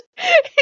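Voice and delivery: loud high real laugh; loud laugh at the story; burst of laughter. Real, not acted. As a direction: break into helpless laughter. Laughs at Odin's words.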